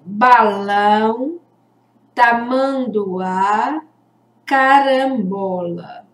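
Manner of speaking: drawn-out model words for the class; slow repetition. Shown as a demonstration. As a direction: say each word slowly so the class can hear the nasal. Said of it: "Vamos falar de novo?".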